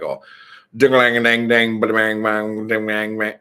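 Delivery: loud, fast unintelligible Louisiana gibberish; Boomhauer. Retold or imitated. Friend's dad coming in talking to the kids, imitated fast garble. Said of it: "talking like fucking Boomhauer".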